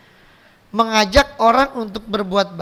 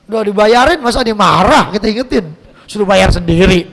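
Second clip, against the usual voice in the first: louder, amused delivery breaking into a laugh; laughing punchline; 9 dB louder than usual. Real, not acted. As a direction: tell the punchline while laughing.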